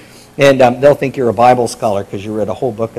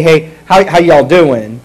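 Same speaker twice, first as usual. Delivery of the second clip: loud, casual Southern hello; Southern greeting; 7 dB louder, slower than usual. Shown as a demonstration. Pastor showing singular y'all, casual Southern greeting. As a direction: greet one person with a Southern "how y'all doing". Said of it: "That's a Southern thing".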